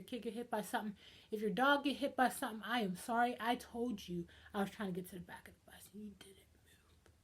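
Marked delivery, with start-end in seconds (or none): 2.7-7.1 soft whispered mock apology to the person bumped. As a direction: whisper the excuse as if to the one you hit.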